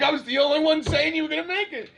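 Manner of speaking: high-pitched insistence all the way through; excited protest. Retold or imitated. Camper telling the friend who made it, excited protest. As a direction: reenact vindicated excitement, voice held high. Answering worried friend in story.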